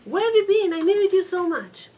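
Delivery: loud, high reproach; angry husband. Retold or imitated. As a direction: scold her, then plead need. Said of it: "He took this bucket, he spilled it".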